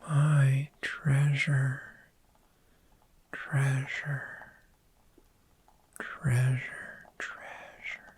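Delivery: slow doting whisper over the treasure, trailing into silence; fading whisper. Acted. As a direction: whisper that dies away to nothing. Doting on the hoard.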